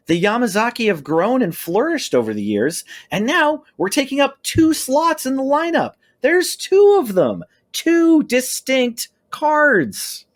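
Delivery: rising to a grand, drawn-out finale; dramatic climax. Acted. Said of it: "I'm pulling out my finishing move".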